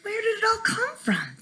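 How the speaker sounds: high, wondering crowd voice; amazed villagers. A narrator's reading. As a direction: ask in wide-eyed wonder.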